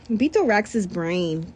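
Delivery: plain reading voice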